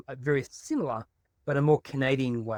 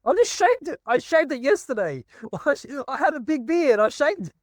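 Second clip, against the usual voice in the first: much higher, louder outburst; real excitement; 12 semitones higher, 5 dB louder than usual. Real, not acted. Telling a fellow beard-wearer about shaving it, excited and high. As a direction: burst out in high-pitched delight, half laughing.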